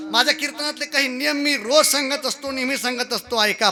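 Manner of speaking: ordinary talk, nothing marked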